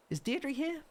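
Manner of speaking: bright, high and eager assistant; high cheerful helper voice. Pretend. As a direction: read it like a chirpy island assistant. Reads helper dialogue in voice.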